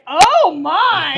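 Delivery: high, loud shocked gasp of words; shocked exclamation. Retold or imitated. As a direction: cry out, scandalized. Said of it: "And she's like".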